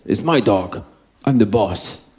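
low, soft big man's voice; low rumble of a large man. Retold or imitated. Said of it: "En hij zegt".

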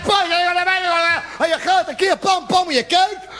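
high, shouted jealous grilling; hammed-up hysterical interrogation rant; Gestapo-style interrogation. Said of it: "Gestapo-verhoor".

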